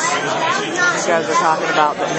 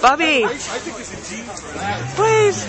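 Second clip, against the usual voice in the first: high sing-song mom voice; 6 semitones higher than usual. Real, not acted. Mother calling to her child.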